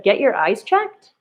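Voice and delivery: rising at the end like a question; questioning rise on checked. Shown as a demonstration. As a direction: ask it instead of exclaiming. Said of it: "if it was a question mark".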